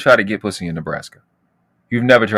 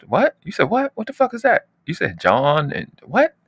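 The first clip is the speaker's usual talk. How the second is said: incredulous; 9 semitones higher than usual. Retold or imitated. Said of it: "I'm like".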